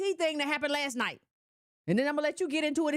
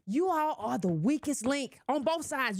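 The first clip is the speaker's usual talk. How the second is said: usual speaking voice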